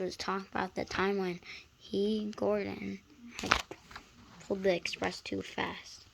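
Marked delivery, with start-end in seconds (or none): none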